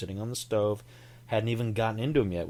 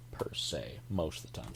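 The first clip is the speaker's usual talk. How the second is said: whispering; 5 semitones lower, 7 dB quieter than usual. Real, not acted. Unvoiced whisper.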